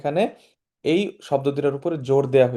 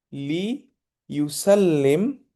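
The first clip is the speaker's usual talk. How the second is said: drawn-out drill word; 3 semitones higher, much slower than usual. Shown as a demonstration. Teacher to students, drilling লিইউসাল্লিম very slowly. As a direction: stretch লিইউসাল্লিম out very slowly, syllable by syllable. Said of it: "teacher drilling the Arabic word".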